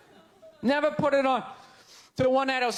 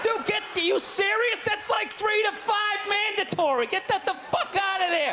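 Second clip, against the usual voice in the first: panicked friend; 7 semitones higher than usual. Retold or imitated.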